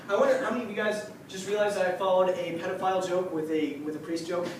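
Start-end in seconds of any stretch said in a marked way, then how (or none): none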